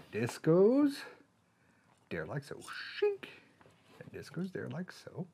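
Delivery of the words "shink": squeaky shink noise; high sound effect. Pretend. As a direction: voice a blade snapping in. Toy clicking in sound.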